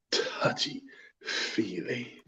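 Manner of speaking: slow, very soft creepy whisper. Acted. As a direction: whisper it slowly for creepy effect. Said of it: "that sounds really creepy".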